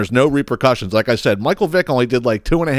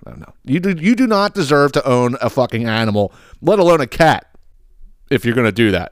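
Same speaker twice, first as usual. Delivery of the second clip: usual speaking voice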